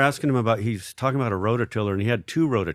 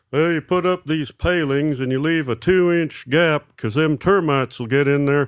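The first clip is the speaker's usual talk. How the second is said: slow, higher know-it-all neighbor voice; instructing neighbor impression; 5 semitones higher, 4 dB louder than usual. Neighbor explaining how to put up palings, slow imitation. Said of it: "he's telling me, like".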